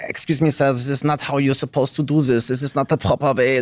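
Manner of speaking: fussy German stickler. Retold or imitated. German corrects someone breaking the rules, fussy and officious. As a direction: imitate an officious, by-the-book German scolding someone. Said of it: "Germans are very on the dot".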